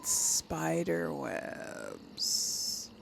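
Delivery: very slow, soft and drawn out; drawn-out ominous murmur. Real, not acted. Gamer spotting webs in a dungeon, drawn-out ominous murmur. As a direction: draw the words out slowly, hushed.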